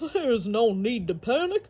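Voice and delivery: lightly voiced, reassuring alligator; calm the boy down, alligator.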